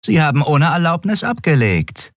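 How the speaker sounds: high-pitched officer's report voice; dutiful first officer. A narrator's reading. Shia reporting to the admiral, dutiful.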